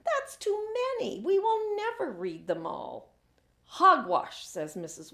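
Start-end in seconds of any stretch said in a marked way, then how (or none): 0.0-3.1 put-on much higher, dismayed child voice; girl complaining to teacher, high and dismayed; shrieking. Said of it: "shrieks Maria". 3.6-4.5 a loud, emphatic dismissal; teacher scoffing, loud and firm; firm exclamation. Acted. Teacher dismisses student's complaint.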